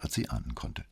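usual speaking voice

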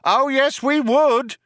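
very high, insistent shout. A narrator's reading. Cop confirms his threat to Trillian, shouted. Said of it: "shouted the cop".

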